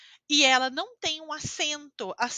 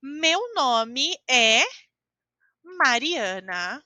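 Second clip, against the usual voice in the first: very slow, louder "Meu nome é Mariana"; slow, careful model answer; 5 dB louder, much slower than usual. Shown as a demonstration. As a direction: dictate it slowly to the class. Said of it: "eu respondo".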